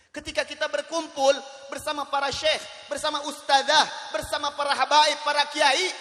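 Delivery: loud, high-pitched preacher's delivery; full-force preaching. Real, not acted. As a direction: drive it out loudly to the whole congregation.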